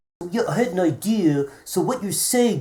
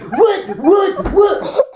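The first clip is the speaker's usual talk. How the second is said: startled; 12 semitones higher, 4 dB louder, much slower than usual. Real, not acted. Startled by prank.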